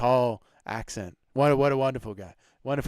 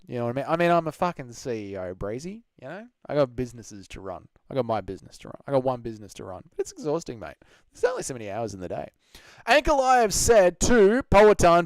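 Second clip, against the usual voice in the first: ordinary talk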